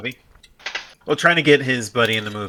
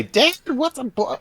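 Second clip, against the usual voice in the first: put-on high, innocent kid asking Dad; naive twelve-year-old. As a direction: imitate a clueless child's question, pitched way up. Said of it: "twelve-year-old me had no idea".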